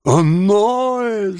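high, drawn-out reproach; reproachful cry. A narrator's reading. Charlotte to Noah who blames her, reproachful cry. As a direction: exclaim it high, as if let down. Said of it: "с упрёком воскликнула Шарлотт".

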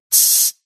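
short hissed shush. A narrator's reading. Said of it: "остановили его оба".

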